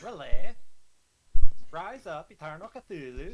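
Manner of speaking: loud, high cultist chant; shouted invocation. Acted. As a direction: call on the god at full volume.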